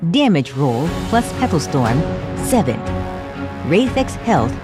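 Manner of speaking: ordinary talk, nothing marked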